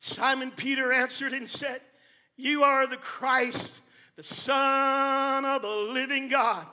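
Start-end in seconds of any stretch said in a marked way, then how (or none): 2.3-6.7 solemn confession. Acted.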